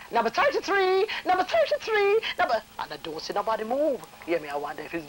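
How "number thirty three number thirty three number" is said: higher, louder repeated call; clerk calling out. Retold or imitated. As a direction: call the number across the room. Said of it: "she said".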